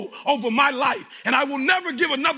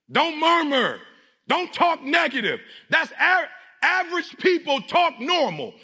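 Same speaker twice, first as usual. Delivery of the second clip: motivational preaching; 5 semitones higher than usual. Acted. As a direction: preach it hard in punchy, repeated phrases. Motivational preaching at full force.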